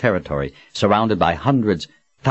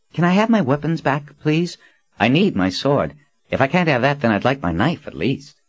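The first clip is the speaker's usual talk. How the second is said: put-on higher, pleading-casual boyish voice; cocky young man; 9 semitones higher than usual. A narrator's reading. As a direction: ask for the sword like a cheeky boy. Young man asks for weapons.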